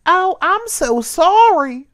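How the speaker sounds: high, slow, loud tearful cricket voice; mock-sob the apology; sobbing. Cricket apologizing to the caterpillar, sobbing. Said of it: "sobbed Cricket".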